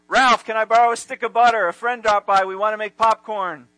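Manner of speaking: loud, cheery neighbor asking a favor; Norton voice. Retold or imitated. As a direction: call through the door, chipper. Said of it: "Norton comes downstairs and he knocks on Ralph's door".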